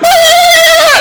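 high startled shriek; startled scream. Retold or imitated. You meet a stranger at home, startled shriek. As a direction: shriek in mock fright. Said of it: "freaks you out".

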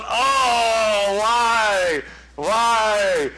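hammed-up whine, long drawn-out wail; whining complaint. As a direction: wail it like a mock sufferer, stretching each word. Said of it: "start whining and complaining".

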